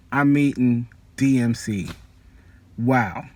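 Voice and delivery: usual speaking voice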